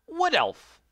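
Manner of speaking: very high, loud squeak; high squeaky mock elf. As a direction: chirp it like a tiny elf. Announcing race in silly voice.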